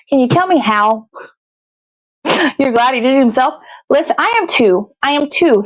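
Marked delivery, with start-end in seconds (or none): none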